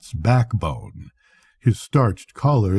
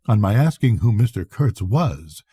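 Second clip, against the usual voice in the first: plain reading voice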